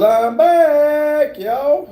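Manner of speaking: high, drawn-out returning cry; hammed-up announcement. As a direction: announce a big comeback in a mock-excited high voice. Acts out a viewer's arrival announcement.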